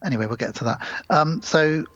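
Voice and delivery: ordinary delivery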